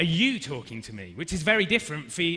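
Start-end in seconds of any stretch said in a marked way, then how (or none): 0.0-1.1 YOU stressed, falling at the end. Shown as a demonstration. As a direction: lean on the word "you". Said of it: "maybe you changed the emphasis".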